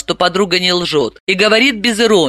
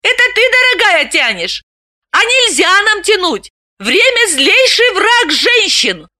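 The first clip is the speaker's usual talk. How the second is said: high, indignant surprise rising to a shout; can't believe what she hears; astonished exclaim. Acted. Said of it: "Галина изумлённо воскликнула".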